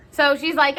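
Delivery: ordinary talk, nothing marked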